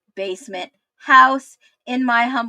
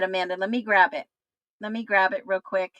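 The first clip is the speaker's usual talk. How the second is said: ordinary talk, nothing marked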